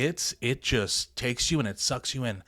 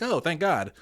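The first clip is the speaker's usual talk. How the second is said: tossed-off, higher-pitched throwaway relief; flippant; 5 semitones higher than usual. Shown as a demonstration. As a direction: say it lightly, as a casual figure of speech. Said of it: "it's not like I say it flippantly".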